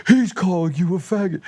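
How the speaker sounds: high-pitched outraged tattletale; exaggerated, high accusing voice; mock indignant bystander. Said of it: "then somebody's like".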